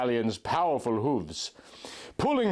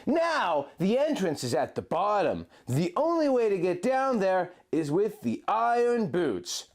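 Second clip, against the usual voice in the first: Link, tender guide; 6 semitones higher than usual. A narrator's reading. Link guiding his love through the blizzard, high and gentle. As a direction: lead her by voice through the snow.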